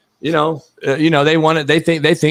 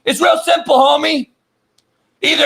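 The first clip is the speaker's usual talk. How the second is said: higher, shouted insistence; insistent shout at a friend; 7 semitones higher than usual. Real, not acted. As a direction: drive it home with a shout. Heated insistence.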